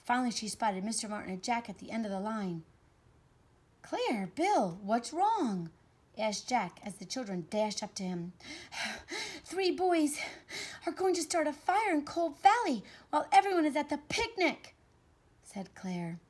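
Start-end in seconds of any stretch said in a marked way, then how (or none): 3.8-5.7 high, loud worried call; swooping, urgent character voice; alarmed call. Acted. Jack calls to arriving children. 8.4-9.4 high breathless puff; acted out-of-breath huff; panting. Children dashed up, out of breath. 9.4-14.8 put-on high, loud, breathless child's report; panicky, high-pitched warning; urgent alarm. Claire reports fire after running.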